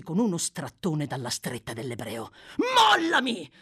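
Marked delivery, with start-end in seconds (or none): 2.7-3.5 loud, high barked order; furious shout. A narrator's reading. Sykes breaks from the old man's grip, shouting. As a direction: yell to be released.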